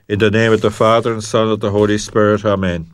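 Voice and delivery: fast, run-together sign of the cross; rushed blessing formula. Acted. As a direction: rattle off the blessing quickly.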